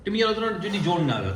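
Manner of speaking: usual speaking voice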